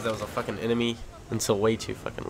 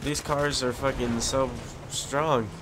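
ordinary talk, nothing marked

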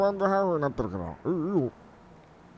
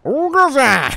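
loud, high greeting shout; calling out; 7 semitones higher, 12 dB louder, much slower than usual. Pretend. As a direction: hail someone by name at full voice. 到着した人に呼びかける. (大声で名前を呼ぶ)

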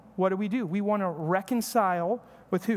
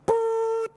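imitated boop; 4 dB louder than usual. Stereo dying in storm.

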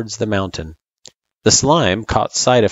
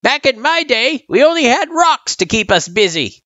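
put-on high-pitched old-timer voice; old turtle, teasing; 4 dB louder than usual. A narrator's reading.

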